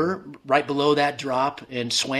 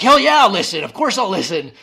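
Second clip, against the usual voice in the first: much higher, louder burst of eager agreement; eager yell; 7 semitones higher, 10 dB louder than usual. Retold or imitated. Answering the voice that offers rescue, a loud eager yell. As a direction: blurt it out, desperate to live.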